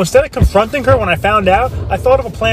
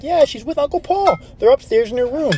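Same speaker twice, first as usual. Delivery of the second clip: a hint of a child's voice, higher; lightly voiced little girl; 8 semitones higher than usual. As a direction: chirp it innocently like a small daughter. Daughter answers on the phone.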